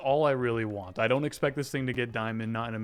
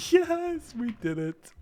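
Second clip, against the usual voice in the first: excited cheer; 14 semitones higher, 4 dB louder, slower than usual. Real, not acted. Round finally cleared.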